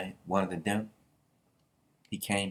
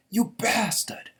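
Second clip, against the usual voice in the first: high, louder, drawn-out insult; angry outburst; 12 semitones higher, 5 dB louder, slower than usual. Acted. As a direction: hurl it as an acted accusation. Angry retort in retold story.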